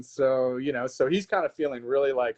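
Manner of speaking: ordinary talk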